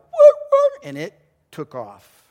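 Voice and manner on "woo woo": very high, loud imitated dog yelps. Preacher imitates the punched dog, high yelping. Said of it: "that dog went".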